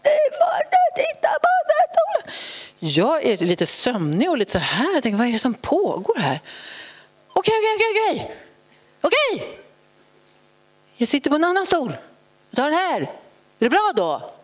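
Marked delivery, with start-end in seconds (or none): 0.0-2.3 very high mock tantrum scream; piercingly high screamed command; shrieking child. 7.3-14.3 high, exasperated giving-in; mock-snippy, high-pitched surrender; nasty tone. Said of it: "Otroligt taskigt tonfall".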